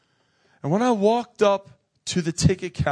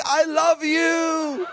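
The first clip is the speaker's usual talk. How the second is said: called out after me; 12 semitones higher, much slower than usual. Retold or imitated. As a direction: shout it across the terminal. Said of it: "she's yelling out after me".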